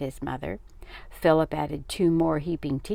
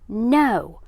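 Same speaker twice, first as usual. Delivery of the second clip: loud, raised angry refusal; roar; 5 semitones higher, 9 dB louder than usual. A narrator's reading. Father refusing his son's stunt, roaring. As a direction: shut it down with a roar. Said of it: "roared his father".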